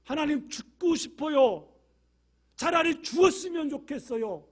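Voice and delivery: loud despairing plea; anguished cry to God. Retold or imitated. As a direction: cry it out like a broken prophet. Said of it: "엘리야가 하나님께 뭐라고 기도했지요?".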